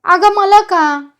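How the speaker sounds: loud, high, drawn-out cry; shouting. A narrator's reading. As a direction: cry out slowly in protest. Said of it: "Namya उठून ओरडला". (मोठ्याने ओरडून)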